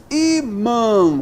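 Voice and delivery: "imã" slow, in two separated syllables, "i" marked; stressed first syllable. Shown as a demonstration. Teacher showing the tonic syllable, "imã" slowly broken in two. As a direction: split "imã" into "i" and long "mã". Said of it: "aqui é a sílaba tônica".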